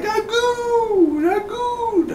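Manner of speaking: very high falsetto crying out Agoo; calling the dog. Pretend. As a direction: wail Agoo like calling a lost pet.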